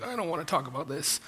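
quick, soft mumble of dismissal; hushed, hurried aside in mock discomfort; mock reluctance. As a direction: shrink away from the topic, playfully. Voices reluctant grammar-averse listener.

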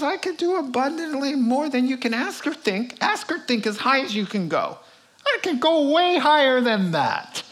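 booming confident divine voice; voice of God. Acted. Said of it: "He says".

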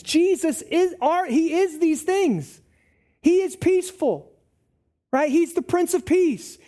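normal talking voice

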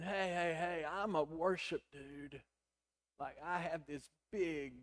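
normal talking voice

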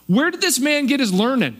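dismissive, keeping him at arm's length; scornful hometown crowd. Retold or imitated. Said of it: "they say".

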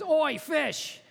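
a cheeky shout of "Oi"; God calling out. Retold or imitated. God hails the fish in the sea, a high bold call. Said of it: "God says".

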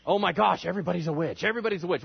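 high-pitched mock panic; hammed-up frantic alarm; hysterical panic. Colonists spreading the rumor of witches, in mock hysteria. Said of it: "hysteria started breaking out".

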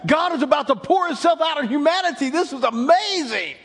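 loud, high, rising preaching voice; full-force preaching. Acted. Peter preaching Joel's prophecy to the crowd, fervent. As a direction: proclaim it like a revival preacher.

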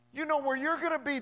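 ordinary talk, nothing marked

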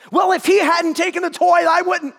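put-on a kid blaming his brother; whiny child. Son voiced, excusing himself.

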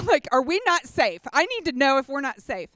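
loud, urgent mock panic; retold fearful demand. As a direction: press hard for reassurance, acting it out. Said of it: "I started to get in fear".

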